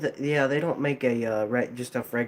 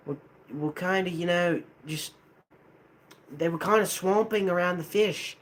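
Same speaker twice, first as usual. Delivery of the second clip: higher-pitched, put-on Australian accent; 5 semitones higher than usual. Shown as a demonstration. Improvising for the viewer who asked, put-on Australian accent. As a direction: play a chatty Australian, halting as you improvise. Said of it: "an Australian accent".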